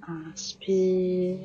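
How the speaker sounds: firm, clear cues over the breath; yoga class cueing. Acted. Yoga teacher guiding students through sun salutations, firm cues. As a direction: call out each pose and breath to the class.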